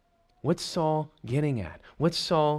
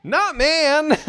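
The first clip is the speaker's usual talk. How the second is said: "Not man" much higher and louder; sharp high stress on "man"; emphatic contrast; 13 semitones higher, 9 dB louder than usual. Shown as a demonstration. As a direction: fire off "Not man" as the punchline. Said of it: "That's interesting".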